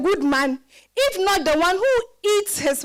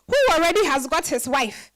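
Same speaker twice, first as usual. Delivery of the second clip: ordinary delivery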